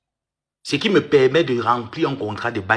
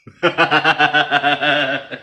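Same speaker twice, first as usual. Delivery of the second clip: laugh; 3 dB louder than usual. Real, not acted. Laughs teasing the aunt.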